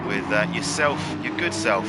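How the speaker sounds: ordinary talk, nothing marked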